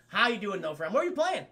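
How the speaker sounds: ordinary talk, nothing marked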